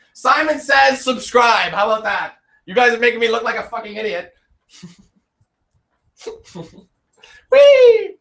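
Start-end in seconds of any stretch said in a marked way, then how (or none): 0.1-1.7 playful shout. Pretend. 7.5-8.2 gleeful high squeal while spinning; spinning in office chair. Real, not acted.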